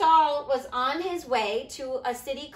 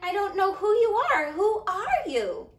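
higher-pitched, stammering bewilderment; confused Saul; 5 semitones higher, 3 dB louder than usual. Acted. Saul to the bright light and voice, confused. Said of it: "Saul was very confused".

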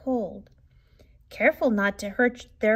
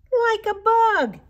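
high, loud, slow echo of Chester's words; a small cub eagerly parroting his brother; little brother echoing; 12 semitones higher, 7 dB louder, slower than usual. A narrator's reading. Ronnie echoes Chester to their mother, high and loud. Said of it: "echoed Ronnie".

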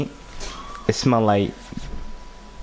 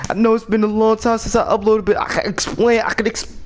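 normal talking voice

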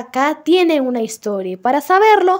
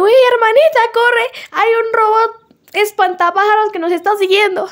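Susie's panicked voice; 8 semitones higher than usual. Retold or imitated. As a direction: play the little sister in a panic, pitched up. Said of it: "Y Susie le dice".

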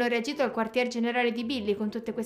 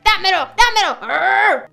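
louder, higher demanding dammelo; possessive grabbing; 6 semitones higher, 8 dB louder, slower than usual. Acted. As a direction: grab it, won't let go. Jealousy grabs controller.

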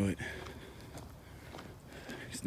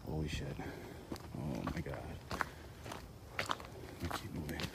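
hushed, frightened voice; scared whisper; 4 semitones lower, 9 dB quieter than usual. Real, not acted. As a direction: whisper it in fear. Scared on a dark trail.

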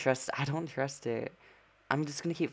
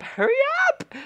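very high, loud screech; urgent squealed shout; frantic yell; 7 dB louder than usual. Real, not acted. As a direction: shriek it to rush them along. Urgent repeat in game.

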